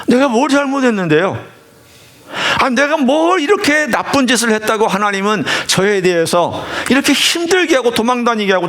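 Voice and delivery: a retold prayer that argues with God; challenging prayer. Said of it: "도전하는 기도를 했어요".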